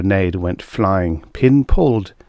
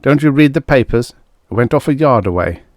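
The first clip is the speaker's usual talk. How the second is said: higher, irritable comeback; grumbling; 5 semitones higher, 4 dB louder than usual. A narrator's reading. Larry deflecting his friend's question, grumbling. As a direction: grumble it as a defensive retort. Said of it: "He grumbled".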